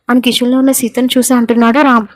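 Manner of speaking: ordinary delivery, nothing marked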